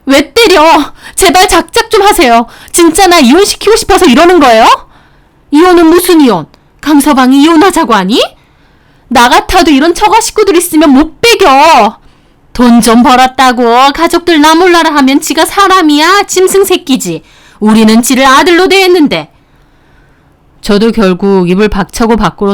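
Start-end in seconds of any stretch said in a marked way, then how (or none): 0.0-4.6 a mock outcry of a hurt wife; wife crying out. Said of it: "아내도 절규하듯 소리 지르더라고요". 5.5-19.3 higher, contemptuous ranting; a hammed-up spiteful mother; scornful mother-in-law. Mother-in-law shouts back.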